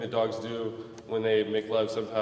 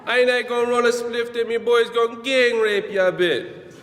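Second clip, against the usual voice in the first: loud, much higher mock Jamaican accent; Satan's Jamaican accent; 11 semitones higher, 8 dB louder than usual. Satan greeting new arrivals in hell, in a loud mock Jamaican accent. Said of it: "Satan talked with a Jamaican accent".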